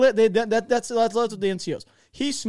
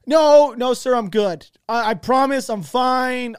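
loud, higher-pitched, drawn-out mock protest; pleading refusal; 5 semitones higher, 8 dB louder, slower than usual. Private begging off to the LT, pleading. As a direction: insist desperately, as a retold plea.

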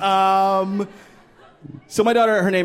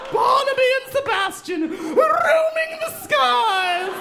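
mock epic wail. Imagining balloon captain brothers, very high mock wail. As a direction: wail it like a tragic saga. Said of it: "mock mother's grand cry".

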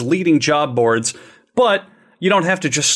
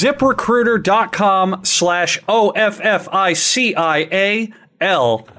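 slow spelled out; 4 semitones higher, slower than usual. Shown as a demonstration. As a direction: spell it out slowly for the deaf. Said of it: "if you didn't hear that 'cause you're deaf".

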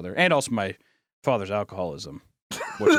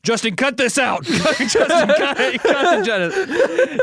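high, loud shout to the producer; squealed, urgent order; laughing panic; 13 semitones higher, 8 dB louder, slower than usual. Real, not acted. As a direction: yell for the cut through laughter.

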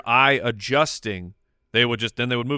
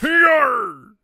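high, loud comic pirate yarr; pirate cry; 13 semitones higher, 7 dB louder than usual. Pretend. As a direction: let out a big playful pirate growl-cry. Pirate cry after pirate question.

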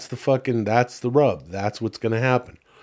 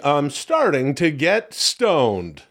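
slow stoned drawl; 3 semitones higher, much slower than usual. Acted.

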